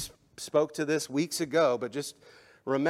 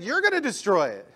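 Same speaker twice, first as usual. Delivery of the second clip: loud, higher preaching of Jesus' words; Jesus declaring loudly; 10 semitones higher, 9 dB louder than usual. Acted. Preacher voicing Jesus to the crowd, loud and rising. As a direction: proclaim it to the crowd. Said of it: "He said".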